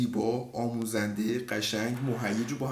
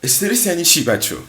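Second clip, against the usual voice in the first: normal talking voice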